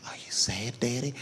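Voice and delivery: put-on soft whispered little kid; lightly voiced small son, whispered; small child's question. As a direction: ask it like a worried toddler. Son voiced asking dad.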